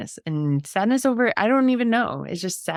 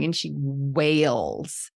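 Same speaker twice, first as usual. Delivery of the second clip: ordinary talk, nothing marked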